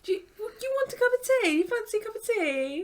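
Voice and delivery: put-on high, hammy British accent; silly British voice. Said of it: "it would always be like".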